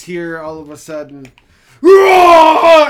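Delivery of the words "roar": huge monster roar, shouted high; dinosaur voice. Acted. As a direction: bellow like a giant beast.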